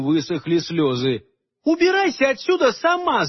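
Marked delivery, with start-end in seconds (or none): 1.6-3.3 shrill high furious yell; shouting. A narrator's reading. Aleonora screaming at the fairy queen. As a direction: scream it back at her. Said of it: "закричала она".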